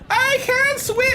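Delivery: high mock panic cry; panicking kid. Streamer mimics the meme kid crying he can't swim. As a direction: yelp like a drowning kid in a meme. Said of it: "that meme of the kid".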